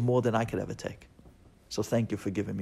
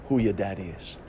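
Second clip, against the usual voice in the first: normal talking voice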